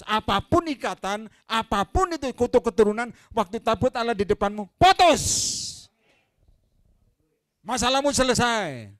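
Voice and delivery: loud, high prophetic declaration building to a shout on putus; climaxing pulpit proclamation; fiery preaching. Acted. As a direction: break the chains with your voice. Preaching at full force.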